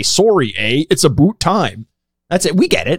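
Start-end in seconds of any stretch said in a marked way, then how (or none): 0.0-1.9 imitated Canadian accent. Quoting people who try a Canadian accent on him, mock Canadian. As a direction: caricature Canadian, "about" as "boot". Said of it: "tried to do a Canadian accent".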